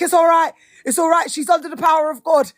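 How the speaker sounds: high, loud, urgent preacher patter; imitated street preacher. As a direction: mimic a preacher loudly reassuring the crowd. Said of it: "You know, he talks like that".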